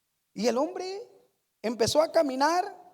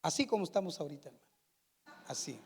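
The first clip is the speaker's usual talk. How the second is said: much softer, lower hush; hushed silence; 9 semitones lower, 10 dB quieter, much faster than usual. Shown as a demonstration. Predicador muestra a la congregación el silencio, en voz muy baja. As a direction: drop to almost nothing to show the silence. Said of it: "quiero completo silencio".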